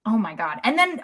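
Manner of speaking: ordinary talk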